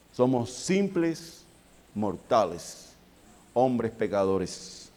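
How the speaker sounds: slow, soft and grave, each word weighed; slow solemn. Acted. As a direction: lay it down slowly like a confession. Predicador lento y solemne. (despacio y solemne, cada palabra pesada)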